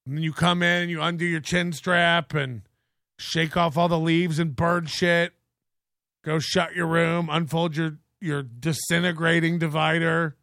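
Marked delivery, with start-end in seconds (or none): none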